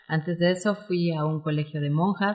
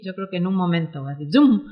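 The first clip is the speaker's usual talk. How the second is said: ordinary delivery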